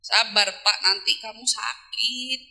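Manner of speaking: calm him down gently, hand on his shoulder; soothing wife. Pretend. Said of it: "Mau nenangin itu pegang pundaknya".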